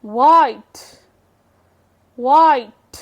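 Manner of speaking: White said loud, slightly low; word demo. Shown as a demonstration. Teacher to students, modelling white loudly. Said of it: "knife".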